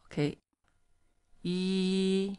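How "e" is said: E held high and flat; first tone e. Shown as a demonstration. Teacher models the first tone, high and flat. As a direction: sing E on one high level pitch. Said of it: "E high flat one".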